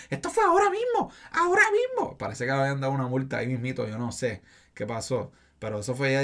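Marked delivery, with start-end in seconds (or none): 0.1-2.2 high-pitched complaining whine; high mock whine. Young woman complaining to the supervisor, high mock whine. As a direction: squeal it out indignantly.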